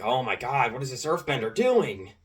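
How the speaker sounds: imitating an irked gamer's whine, rising on the end; mock exasperation, voice climbing; exasperated complaint. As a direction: complain like a fed-up teammate. Retold trash-talking player complains.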